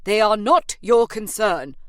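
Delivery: loud, stern agent; cutting her off. A narrator's reading. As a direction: shut her down firmly.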